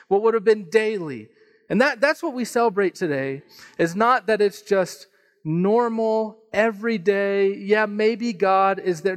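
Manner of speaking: preaching. Acted. Pastor drives home the resurrection message, preaching. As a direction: build the point to the congregation.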